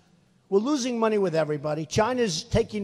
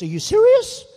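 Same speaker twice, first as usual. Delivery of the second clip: high, loud mock disbelief; incredulous; 12 semitones higher, 9 dB louder than usual. Ambassador told of her new post, hammed-up astonishment. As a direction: squeal it like she can't believe it. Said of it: "She goes".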